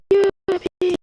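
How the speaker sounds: high squeal of joy; cheering. Real, not acted. Cheering success. (youpi aigu et joyeux)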